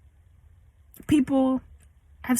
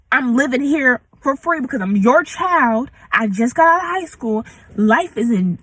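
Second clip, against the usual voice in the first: venting at mom, building to what do you want me to do; heated rant; 3 semitones higher, 3 dB louder than usual. Real, not acted. Ranting back at her mother.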